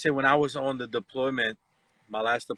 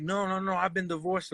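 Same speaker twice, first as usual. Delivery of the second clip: higher, lightly mimicked protest; imitated denial; 6 semitones higher, 4 dB quieter than usual. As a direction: mimic a scammer hurriedly denying it. Scammer denying being married when challenged.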